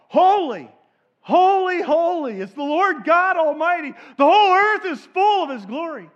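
high, loud, ringing cry; angelic proclamation. Acted. Angels crying out before God's throne, loud and ringing.

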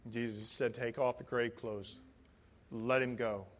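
low, soft, understated command; hushed, low, matter-of-fact instruction; quiet calm. Acted. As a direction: drop right down and say it gently. Calm after the crowd's shock.

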